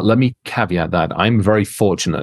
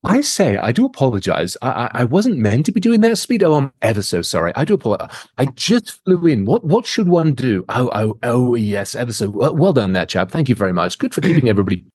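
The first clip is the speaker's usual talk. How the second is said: mock English politeness, stammering apologies; hammed-up English gentleman; 3 semitones higher than usual. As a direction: play the bumbling, terribly polite English chap. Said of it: "I'm hiring an English guy".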